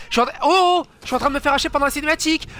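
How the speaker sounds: high, loud, fast indignant protest; rapid squeal of outrage; outraged disbelief. Real, not acted. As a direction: complain at the unfair game in a rush. Killed during cutscene, protesting.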